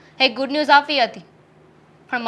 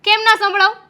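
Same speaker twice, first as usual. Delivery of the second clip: higher, sharp retort; angry retort; 7 semitones higher, 5 dB louder than usual. Acted. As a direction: snap back at him. Wife retorts in quarrel. (ગુસ્સાથી સામો જવાબ)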